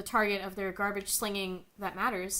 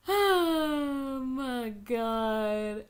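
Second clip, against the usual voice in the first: very slow, stretched groan of disbelief; long exasperated oh my god; drawn-out disbelief; 3 semitones higher, 4 dB louder, much slower than usual. Real, not acted. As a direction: drag it out, exasperated. Disbelief at the article.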